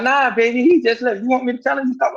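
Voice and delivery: lightly imitated grandma, pitched high; high-pitched quoted grandma reply; grandma at the door. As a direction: retell her answer in a high, easy voice.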